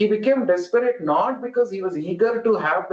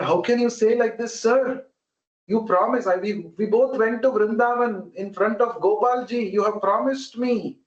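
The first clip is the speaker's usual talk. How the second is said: higher, urgent plea to a promise-breaker; pleading; 7 semitones higher than usual. Retold or imitated. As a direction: plead with the old man, voice raised high. Young man pleads with old man.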